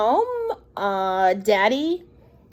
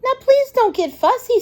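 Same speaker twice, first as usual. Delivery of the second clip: a lightly raised, coaxing dad voice; gently head off a tantrum; mildly pleading daddy; 12 semitones higher, 3 dB louder than usual. Acted. Daddy coaxing his fussing toddler, a touch higher and pleading. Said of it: "said her daddy".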